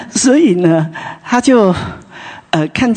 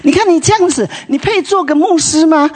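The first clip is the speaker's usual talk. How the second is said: high, raised scolding voice; mock scolding; 9 semitones higher than usual. Husband scolding his wife so the neighbours hear. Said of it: "故意大声的骂说".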